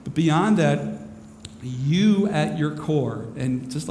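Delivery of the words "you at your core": slowly. Shown as a demonstration. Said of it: "I'll say this slowly".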